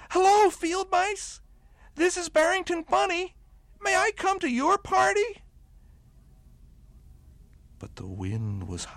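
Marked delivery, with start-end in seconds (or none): 0.1-5.4 high, loud little bunny calling out; tearful blurt. A narrator's reading. Lonely bunny calling down to the field mice, high and loud. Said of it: "blurted out through his tears".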